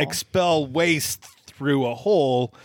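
deliberate, over-careful pretend human; alien posing as human. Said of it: "He sounded like an alien".

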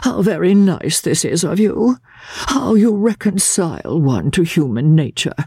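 high, affectedly appreciative gentleman; indolent invalid. A narrator's reading. Invalid thanking the lawyer, affectedly languid. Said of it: "he opened them indolently".

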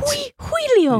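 squeaky goofy Julio; high silly voice. Pretend. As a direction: pitch way up for a joke. Silly name guessing game.

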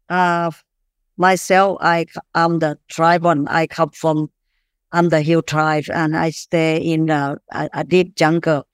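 ordinary talk, nothing marked

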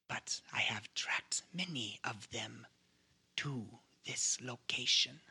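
slow, hissing whispered accuser; a cold hunter whispering slowly. Acted. GM voices the suspicious hunter.

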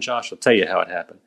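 ordinary talk, nothing marked

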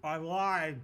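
talking with mouth full; eating while talking. Real, not acted. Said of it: "I'm eating the Earth Bowl".

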